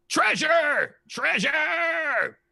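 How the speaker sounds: very high, loud greedy creature cry; dragon voice. Acted. Dragon eats the rations and shouts for treasure, high loud cry. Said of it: "eats them and says".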